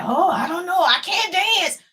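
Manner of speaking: playful mimicry of self-doubt; mock reluctance. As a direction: act out a hesitant, whiny refusal. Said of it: "And you're like".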